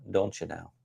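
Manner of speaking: normal talking voice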